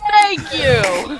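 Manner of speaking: loud thanks. Acted. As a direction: blurt it out in relief. Exclaiming agreement loudly.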